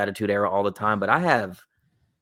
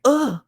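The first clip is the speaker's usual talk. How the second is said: mock disgusted ugh; 4 dB louder than usual. Girl's disgusted reaction.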